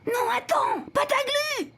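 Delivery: high, urgent cry to stop; alarmed call. Acted. Alarmed child tries to stop friend.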